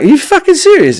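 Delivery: high-pitched incredulous squeal; mock disbelief. Husband reacting to wife's request, mock disbelief. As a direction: shoot up high, can't believe it.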